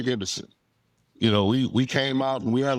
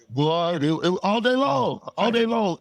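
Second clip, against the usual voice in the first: excited; 7 semitones higher than usual. Real, not acted. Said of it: "excited recollection rising".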